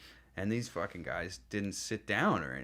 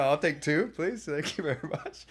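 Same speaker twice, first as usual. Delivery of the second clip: overly polite eager; 7 semitones higher than usual. Retold or imitated. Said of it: "Yeah, yeah, yeah".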